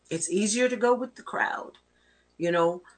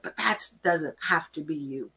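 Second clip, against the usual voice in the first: ordinary delivery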